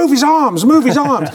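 an urgent puppeteer instruction; puppeteer whisper-shout. Retold or imitated. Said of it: "Jim Henson to be in the back, going".

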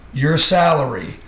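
ordinary talk, nothing marked

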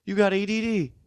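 very high-pitched Cape Breton caricature; Cape Breton accent. Acted. East Coaster telling a pal he's got ADD, Cape Breton accent. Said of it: "That's the Cape Breton".

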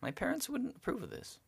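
retold date's confession. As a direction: softly mimic an awkward confession. Voices his date's awkward confession.

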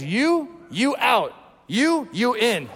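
high, drawn-out "you", falling off sharply; imitating a captain picking his team; curt picking command. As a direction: point out each player with a slow, emphatic call. Team picker choosing players on court.

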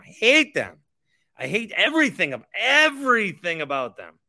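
I hate them shouted high and loud; furious outburst at rival team's fans; angry shout. Real, not acted. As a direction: yell it out in real fury. Ranting about hated team.

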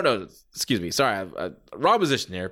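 ordinary delivery

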